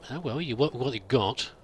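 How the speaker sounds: ordinary talk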